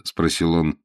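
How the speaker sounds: usual speaking voice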